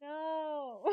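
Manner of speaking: ordinary delivery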